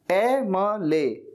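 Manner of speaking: "ए म ले" in slow separate syllables; sounded-out MLA. Shown as a demonstration. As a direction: break "ए म ले" apart, very slowly. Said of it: "यहाँ पर आप देखिए ना".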